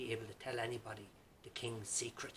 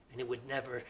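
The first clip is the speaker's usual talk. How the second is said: ordinary delivery, nothing marked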